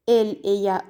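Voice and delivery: usual speaking voice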